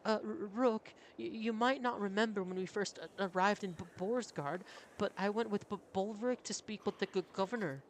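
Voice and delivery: high, stammering nervous voice; nervous stutterer. Acted. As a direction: stumble over every name, high.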